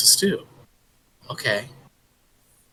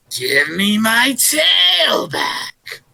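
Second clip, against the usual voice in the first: spooky monster shout; 6 semitones higher, 4 dB louder, much slower than usual. Acted. Creature calling again.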